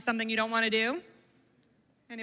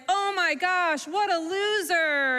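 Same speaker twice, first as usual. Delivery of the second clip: drawn-out, higher-pitched playground taunt; acted teasing friend, stretched and higher; mocking jeer; 6 semitones higher, 3 dB louder, slower than usual. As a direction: jeer slowly at a kid who fell. Friend points and jeers at fallen kid.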